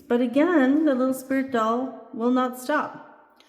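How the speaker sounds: plain reading voice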